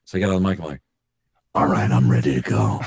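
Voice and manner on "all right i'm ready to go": low, smoky voice after a drink; smoky, dark. Retold or imitated. Back at the mic after whisky, smoky voice. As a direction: drop deep and dark for the mic. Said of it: "a little more smoky, a little darker".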